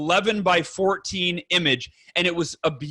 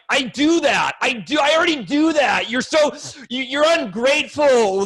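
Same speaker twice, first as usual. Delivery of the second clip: imitating a defensive husband, higher-pitched, indignant; defensive protest; 5 semitones higher than usual. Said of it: "get defensive".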